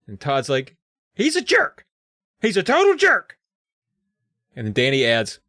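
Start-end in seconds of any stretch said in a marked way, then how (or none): 1.2-3.4 put-on high, loud childish insult; sulk-shout like a jealous boy; high kid insult. Todd insists in story.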